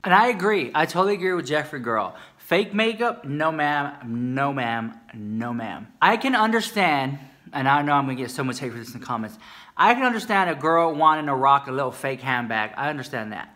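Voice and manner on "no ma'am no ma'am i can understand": ordinary talk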